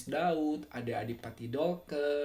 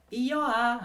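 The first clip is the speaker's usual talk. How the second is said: higher Malang-accented exclamation; 8 semitones higher, 7 dB louder than usual. Shown as a demonstration. Comparing regional endings for viewers, emphatic Malang accent. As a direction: toss it out like someone from Malang. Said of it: "Kalau orang Malang tuh".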